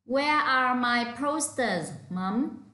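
Where are my posters said slowly and clearly, stress on where; slow model sentence. Shown as a demonstration. Teacher modelling the question for pupils to repeat. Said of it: "repeat after me".

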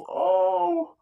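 pained high cry; a short tormented wail; anguished cry. Acted. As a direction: cry out as your head splits. Head bursting in torment.

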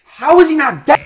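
high, loud incredulous cry; exasperated, baffled outburst; loud disbelief. Real, not acted. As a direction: yell it in disbelief, pitch jumping up. Disbelief when enemy survives in game.

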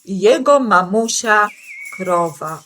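plain reading voice

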